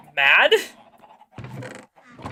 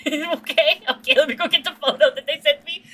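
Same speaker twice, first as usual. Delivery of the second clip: laughing; 6 semitones higher, 3 dB louder than usual. Real, not acted. Laughing while fetching the photo.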